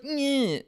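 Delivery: high-pitched mocking "nyeh"; imitated high whiny noise; dismissive "nyeh". Mimicking the rude guy pushing past, mocking noise. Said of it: "he just, like, does, like".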